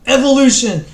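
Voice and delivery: loud, high, slow shouted proclamation; showman announcer. Pretend. T-shirt slogan from an American reader, proclaimed in a loud, slow announcer voice. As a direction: bellow it like a circus barker.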